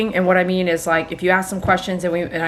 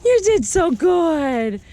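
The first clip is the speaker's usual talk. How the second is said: talking to a dog; 10 semitones higher than usual. Real, not acted. Groomer praising dog.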